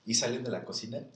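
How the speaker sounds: usual speaking voice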